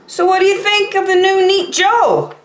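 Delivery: high, bright proud question; proud and eager. Acted. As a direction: show off to the family. Proud kid asks family.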